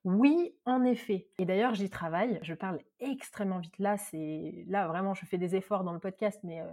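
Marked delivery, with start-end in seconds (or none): none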